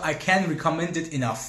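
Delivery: slow emphatic English. Real, not acted. Quotes an English phrase.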